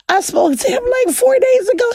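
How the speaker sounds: squeaky high laughing words; high, giggly disbelief. Real, not acted. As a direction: laugh in disbelief as you speak.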